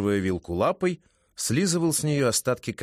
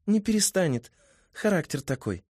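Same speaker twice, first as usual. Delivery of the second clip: high female voice; 8 semitones higher than usual. A narrator's reading. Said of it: "Кикимора только хихикнула".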